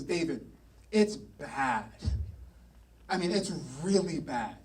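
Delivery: low, hushed, acted-out warning; grave warning. Jonathan finds David to warn him, low and grave. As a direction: break bad news to a friend, low and soft.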